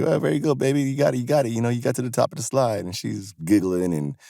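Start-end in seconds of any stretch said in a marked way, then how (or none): 0.0-2.8 higher-pitched encouraging parent voice; retold cheering. Dad cheering his daughter up the slide, retold cheering. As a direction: cheer on a small child, pitched up. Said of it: "and I cheered for her".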